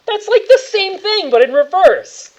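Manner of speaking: loud, high, slow drawn-out jibe; amused jeer. Real, not acted. GM jeering at a player's swapped digits, slow and loud. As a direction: stretch the joke out. Joking about reversed digits.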